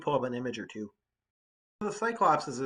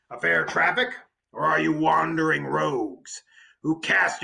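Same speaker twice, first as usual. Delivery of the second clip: booming, high, loud giant's challenge; Cyclops voice; 3 semitones higher, 8 dB louder than usual. Acted. Cyclops confronting the strangers in his cave, booming voice. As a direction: bellow it like a suspicious giant. Cyclops questioning Odysseus's men.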